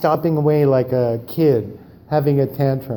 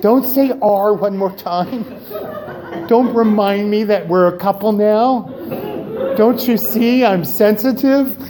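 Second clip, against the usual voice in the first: mock scolding; 4 semitones higher than usual. Scolding husband in store.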